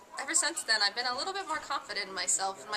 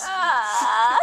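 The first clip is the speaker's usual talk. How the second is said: shouting; 2 semitones lower, 11 dB louder than usual. Real, not acted. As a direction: yell it at the camera. Shouting name at camera.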